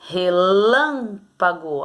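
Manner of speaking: "Relâmpago" said very slowly, each syllable apart; slow sounding out. Shown as a demonstration. Teacher sounding out "Relâmpago" for pupils, very slow. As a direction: stretch "Relâmpago" so the stress can be heard. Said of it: "falar com calma pra você descobrir".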